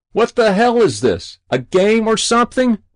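high, loud, indignant shout; exasperated yell. A narrator's reading. Winded fireman to the boy on the roof, exasperated yell. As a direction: yell it up in disbelief. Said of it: "the winded fireman yelled".

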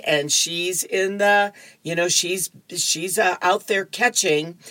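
ordinary talk

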